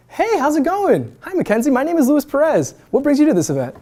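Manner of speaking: high, breezy event greeting; friendly opener. Shown as a demonstration. Student introducing himself at networking event, friendly opener. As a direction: greet a stranger lightly, pitched well up. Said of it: "It's as simple as".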